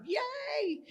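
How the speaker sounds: high squeaky little yay of relief; a sudden high-pitched cheer, thrown away; mock cheer. Mom cheering that Dad can finally retire, in a high squeal. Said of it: "before mom's had a moment to go".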